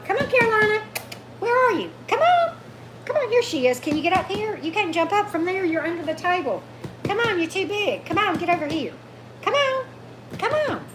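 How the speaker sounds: high, coaxing pet voice to the dog; sing-song sweet talk to lure a shy dog out; calling the dog. Real, not acted. Coaxing the dog out from under the table.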